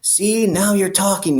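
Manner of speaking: high, slow, satisfied cop voice; pleased cop. Retold or imitated. Cop pleased the inmate seems to give in, high and slow. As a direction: savor the win like a crooked cop. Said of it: "And he goes".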